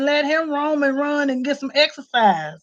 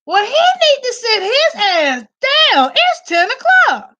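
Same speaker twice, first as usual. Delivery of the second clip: higher, louder indignant complaint; 9 semitones higher, 3 dB louder than usual. Retold or imitated. Scolding the neighbor's dog at night, high and indignant. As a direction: huff it like it's way too late. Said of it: "And I'm like".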